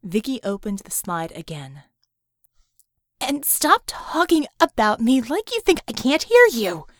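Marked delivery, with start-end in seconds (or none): 3.2-6.9 loud, high angry shout. Acted. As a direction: yell it across the room, furious. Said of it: "she shouted".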